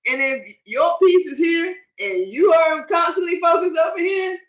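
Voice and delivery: bad connection, voice dropping out; fading in and out. Real, not acted. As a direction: voice cutting in and out. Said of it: "Your voice is fading in and out".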